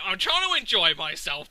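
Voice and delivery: ordinary delivery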